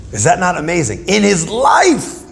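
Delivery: amazed exclamation. Real, not acted. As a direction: stretch it out, rising in amazement. Marveling at the sage's feat.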